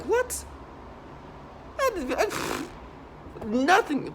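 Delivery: sudden high baffled squeak; baffled what. Retold or imitated. Recalling the voices in his head, a high baffled what. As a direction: jump up high, lost for words. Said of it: "it's like".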